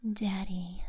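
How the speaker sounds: creepy whispered word; whispering. Pretend. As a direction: breathe it like a creep.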